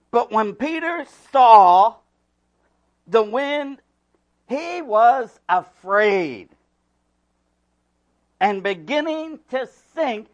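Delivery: loud, rising preacher's voice; full-force preaching. Acted. Pastor reading scripture loud to the congregation. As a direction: preach it out to the back row.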